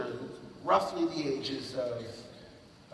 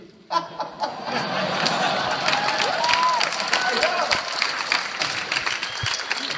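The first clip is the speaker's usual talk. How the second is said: loud, high burst of laughter; a howling real laugh; big laugh; 14 semitones higher, 9 dB louder than usual. Real, not acted. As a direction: crack up at the memory. Laughing at the GQ cover.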